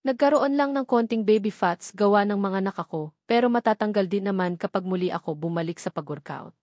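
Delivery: ordinary delivery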